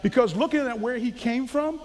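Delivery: ordinary talk, nothing marked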